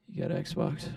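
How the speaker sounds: hushed, furtive whisper like a dealer; imitating a kid sidling up to whisper; mock secretive whisper. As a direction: slip the question out so nobody else hears. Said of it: "like you're trying to offer me ketamine at school, but you don't want anyone to see it".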